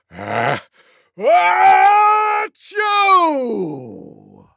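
short wind-up, then huge long high "achoo"; loudest sneeze. A narrator's reading. Tiger outdoes everyone, enormous mock sneeze. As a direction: let out an enormous fake sneeze. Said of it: "gave the loudest sneeze".

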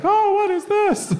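high, drawn-out mock discovery; mock surprise. Comic reenacting the gun being found, mock surprised.

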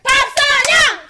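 slow loud high call; winner shout. Real, not acted. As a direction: proclaim the winner. Announcing the winner.

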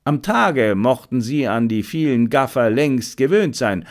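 plain reading voice

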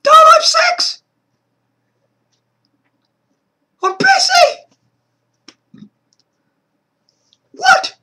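high, loud shriek of a title; shrieking shout. Acted. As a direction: shriek it at the top of your voice.

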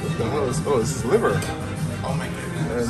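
normal talking voice

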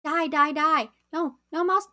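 excited yelling. Real, not acted. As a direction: chant it at the monster. Shooting at the game monster.

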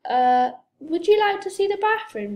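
high, loud, awkward offer. A narrator's reading. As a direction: cover up embarrassment with politeness. Flustered mum covers up embarrassment.

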